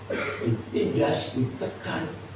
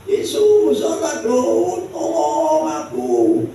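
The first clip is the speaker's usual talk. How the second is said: Bartimeus; 4 dB louder, slower than usual. Acted. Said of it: "teriakan Bartimeus".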